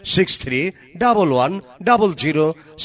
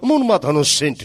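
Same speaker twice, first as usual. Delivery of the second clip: ad announcer; 5 semitones higher than usual. Acted. Loud mic ad promo.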